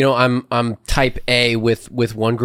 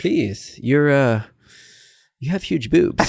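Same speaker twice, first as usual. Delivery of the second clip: suave guy voice; slower than usual. Retold or imitated. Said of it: "the Doki Doki is when everyone's just like".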